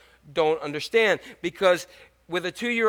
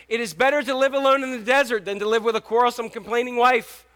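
higher, emphatic verse reading; proclaiming scripture; 6 semitones higher, 5 dB louder than usual. Real, not acted. Preacher reading Proverbs to nervous husbands, proclaiming scripture. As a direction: announce the proverb boldly to the room.

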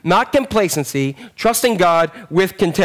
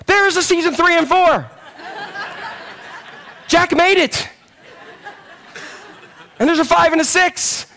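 laughing; 11 semitones higher than usual. Real, not acted. Laughing at own realization.

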